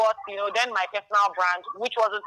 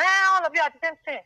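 high-pitched imitation of a dismissive woman; mock dismissive retort; 9 semitones higher, 4 dB louder than usual. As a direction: brush the protest aside in a raised, higher voice. Imitating woman dismissing her objection.

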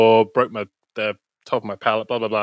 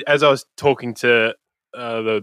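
ordinary talk

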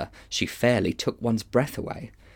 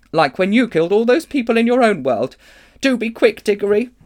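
put-on sharp girl's voice; 12 semitones higher, 6 dB louder than usual. Polly scolding, urging.

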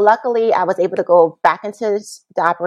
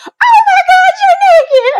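much higher, louder shocked mom; shocked mother; 8 dB louder than usual. Retold or imitated. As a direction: shriek it like a stunned mother. Mother shocked seeing her naked.

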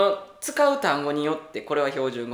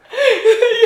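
loud high laugh; 14 dB louder than usual. Real, not acted. Laughing hard at friend's sudden standard speech.

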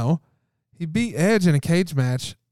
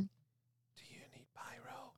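whispering; 5 semitones higher, 27 dB quieter, slower than usual. Real, not acted. Off-mic cue during live show.

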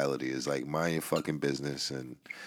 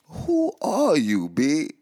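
high, slow and baffled who-are-you; suspicious stranger; 10 semitones higher, 6 dB louder, much slower than usual. Retold or imitated. New Yorker confronting an overly friendly stranger, high and suspicious. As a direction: pull back like a wary New Yorker eyeing a stranger. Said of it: "people looking at me like".

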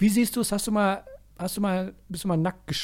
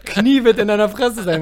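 laughing; 3 semitones higher, 7 dB louder than usual. Real, not acted. Laughing while threatening jokingly.

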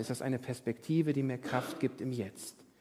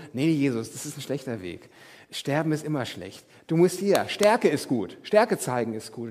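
disciples protesting; 2 semitones higher, 8 dB louder than usual. Retold or imitated. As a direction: push back hard, insisting on strength. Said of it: "Und die Jünger so". (laute, drängende Jünger)

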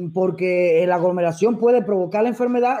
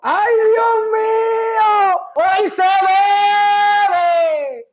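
much higher, louder, very drawn-out cry of joy; jubilant shout; 11 semitones higher, 4 dB louder, much slower than usual. Real, not acted. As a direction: stretch it out like a wild party cheer. Jubilant outburst over rumored death.

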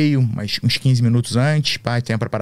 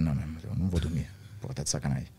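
fast, low and soft grumble; muttering to himself, low and quick; stubborn mutter; 8 semitones lower, 13 dB quieter, faster than usual. Retold or imitated. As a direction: grumble it to yourself. Quoting his own resolve.

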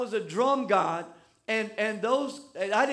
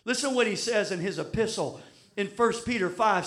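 usual speaking voice

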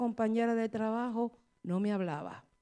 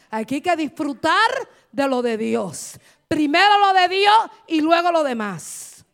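louder, higher repeat, "de lo de Dios" slowed; preaching at full force; 6 semitones higher, 12 dB louder than usual. Acted. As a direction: drive the point home from the pulpit. (repitiéndolo más fuerte y alto)

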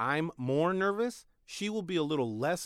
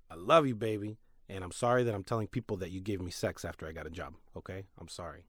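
lower, softer sorry to wife; quiet, earnest aside to the wife; sincere apology; 7 semitones lower, 4 dB quieter, faster than usual. Real, not acted. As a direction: fade into a low apology.

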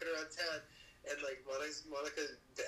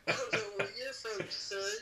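higher mock southern racer drawl; southern heckler; 8 semitones higher, 3 dB louder than usual. Friends re-enacting the heckling racer, mock southern accent. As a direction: play the cocky southern racer, half laughing.

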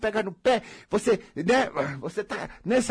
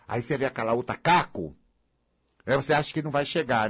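ordinary delivery

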